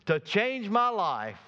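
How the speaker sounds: high, forceful preacher's prayer; emphatic plea. Retold or imitated. Preacher voices Jabez praying to be changed, emphatic. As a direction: pray it emphatically, drawing out the last words. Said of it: "What he was saying emphatically was".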